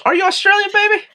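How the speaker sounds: high teasing coo; playful high tease. Pretend.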